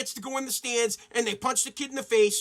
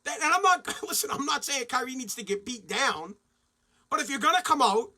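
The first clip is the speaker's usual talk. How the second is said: ordinary talk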